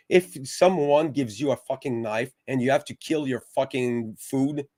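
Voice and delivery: ordinary talk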